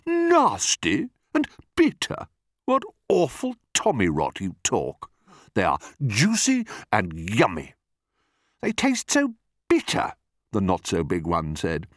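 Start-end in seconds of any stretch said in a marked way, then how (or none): 0.1-7.7 scandalised crocodile; indignant cry. A narrator's reading. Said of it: "cried the enormous crocodile". 8.6-10.1 high-pitched peevish complaint; disgusted not-so-big crocodile. Acted.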